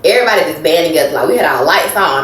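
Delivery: ordinary talk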